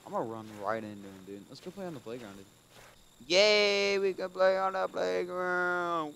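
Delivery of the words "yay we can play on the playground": put-on high, loud and slow childlike cheer; sing-song kid voice, much higher and louder; excited little kid. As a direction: squeal it like a thrilled kindergartner. Repeats line as excited child.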